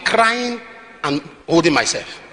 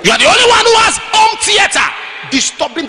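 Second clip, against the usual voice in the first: full-force scolding preacher; preaching shout; 10 semitones higher than usual. Acted. Preacher scolding the noisy neighbour.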